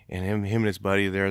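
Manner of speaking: ordinary talk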